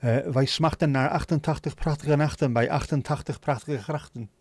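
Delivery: rapid Dutch with throaty ch sounds; Dutch tongue twister. Shown as a demonstration. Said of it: "what I can do in Dutch".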